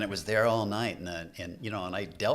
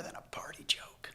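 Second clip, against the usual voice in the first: hushed whispered confession; breathy secret aside; 9 dB quieter than usual. Real, not acted. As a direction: drop to a whisper as if sharing a secret. Confessing the irony softly.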